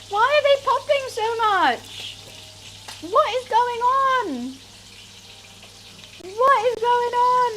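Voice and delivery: high, loud panicked cry; alarmed. Real, not acted. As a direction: panic at the popping oil. Oil spitting, alarmed.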